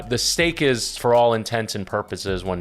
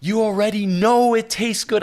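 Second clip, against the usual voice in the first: hammed-up wifely exasperation, pitched way up; imitating exasperated wife; 12 semitones higher, 4 dB louder than usual. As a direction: scold him in a mimicked high voice. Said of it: "I'm not gonna do her accent".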